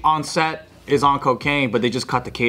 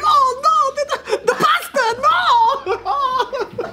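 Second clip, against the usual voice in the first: very high, hammed-up Italian wail; mock Italian accent. Italian caterer mourning his food, mock Italian wail. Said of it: "And then he's like".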